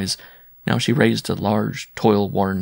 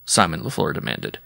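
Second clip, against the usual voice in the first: usual speaking voice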